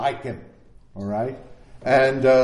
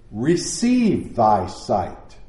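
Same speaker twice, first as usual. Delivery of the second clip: gentle command; 6 semitones lower, slower than usual. A narrator's reading. Jesus speaks healing word.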